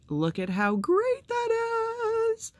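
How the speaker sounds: high, slow, gushing squeal of delight; real excited squeal, stretched out. Real, not acted. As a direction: coo over it like a treasure. Gushing over a gift magnet.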